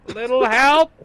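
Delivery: high, strained, rising plea; Guildmaster calling for help. Acted. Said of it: "the familiar voice of the Guildmaster".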